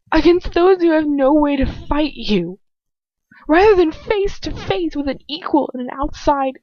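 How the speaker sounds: defiant prisoner shouting at her tormentor; furious outburst. Acted. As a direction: hurl every question at him in rage. She finally shouts back at him.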